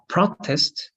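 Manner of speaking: "protest" slowly, with PRO stressed; stress on first syllable. Shown as a demonstration. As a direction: model the noun "protest", weight on PRO. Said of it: "the stress of the word can change".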